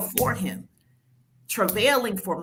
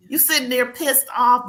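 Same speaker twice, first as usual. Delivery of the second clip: ordinary delivery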